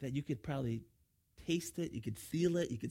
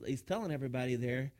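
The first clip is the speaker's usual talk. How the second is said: ordinary talk, nothing marked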